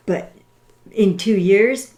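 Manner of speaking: ordinary talk, nothing marked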